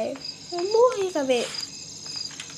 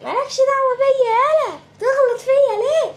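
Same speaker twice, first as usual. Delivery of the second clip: mock angry woman; 11 semitones higher, 5 dB louder than usual. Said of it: "فجت بتقولي".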